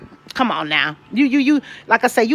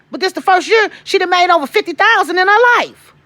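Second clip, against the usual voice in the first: higher, louder proud bragging; proud and excited; 7 semitones higher, 4 dB louder than usual. Real, not acted. Mother bragging about daughter, higher and louder. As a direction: crow about her success.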